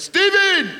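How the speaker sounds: high loud mother's yell; shrill mock mum calling Stephen; mum's shout. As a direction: screech his name like a cross mum. Mum voiced in story.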